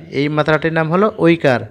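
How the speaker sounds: ordinary talk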